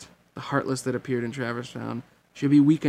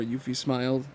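plain reading voice

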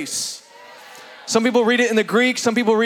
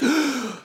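first breath; 3 semitones higher, 3 dB quieter than usual. Acted. Jesus takes first breath.

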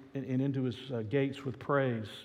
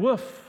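a high, louder playful woof; high mock bark; 10 semitones higher, 7 dB louder than usual. Dog answering the butcher with a high bark. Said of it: "barked the dog".

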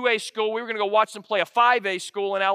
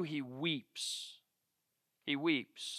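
hushed; 6 semitones lower, 15 dB quieter, much slower than usual. Acted. Pastor hushing the room over Joseph's tears, softly. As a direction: drop to a near hush on he weeps.

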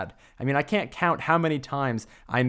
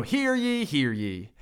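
town crier. Acted. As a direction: proclaim it like a herald. Closing proclamation of the intro.

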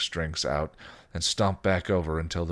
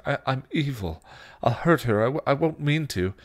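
higher, stammering, desperate plea; begging; 7 semitones higher than usual. Acted. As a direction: plead fearfully, stumbling over words. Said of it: "he begged".

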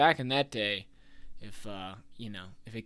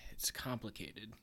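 whispered aside; 14 dB quieter than usual. Real, not acted. Hushed aside.

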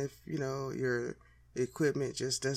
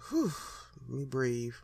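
ordinary delivery

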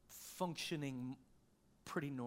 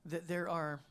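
usual speaking voice

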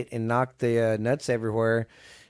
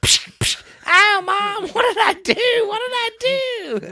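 put-on very high wailing kid voice, louder; kid getting soap; 5 dB louder than usual. The kid in the back crying out, high whiny wail. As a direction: whine like a punished little boy. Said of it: "you hear that kid in the back going".

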